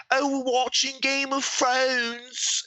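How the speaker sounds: high, slow put-on girly voice; mock her in a high, drawn-out tone; mock Georgia. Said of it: "Georgia was on and she was like".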